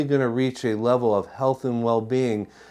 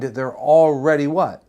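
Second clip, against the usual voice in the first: ordinary delivery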